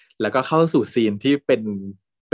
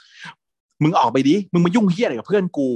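furious yell; 8 semitones higher, 4 dB louder, slower than usual. Retold or imitated. Friend punching the boyfriend.

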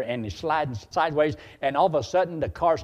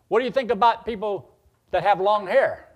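put-on raised, higher kid's question; challenging question; 7 semitones higher, 7 dB louder than usual. Kid at a ranch meeting questioning the leader, challenging. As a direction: call it out loudly from the crowd.

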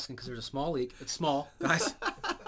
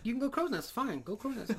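higher, grudging captain; 9 semitones higher than usual. Retold or imitated. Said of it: "He's like".

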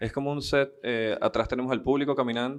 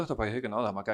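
ordinary delivery

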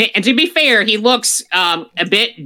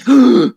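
sharp high mock gasp; surprised gasp; 8 semitones higher, 6 dB louder than usual. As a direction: gasp as if shocked. Mock surprise at corpse rising.

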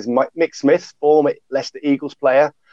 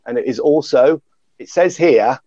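usual speaking voice

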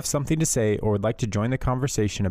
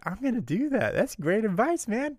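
starstruck excitement; 10 semitones higher than usual. Retold or imitated. Mocking awe at bad advice.

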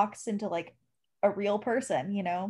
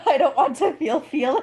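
loud, high whine through laughter; playful protest; 5 semitones higher, 5 dB louder than usual. Real, not acted. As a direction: whine it loudly, half laughing. Playful protest at feeling sad.

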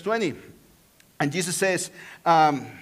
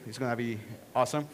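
ordinary talk, nothing marked

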